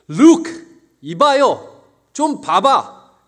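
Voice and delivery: loud, high, slow indignant shout; rude angry shout. Acted. Elder son rudely shouting at his father, loud and high. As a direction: yell at the father with contempt. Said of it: "무례하게 얘기해요".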